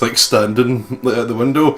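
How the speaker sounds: ordinary talk, nothing marked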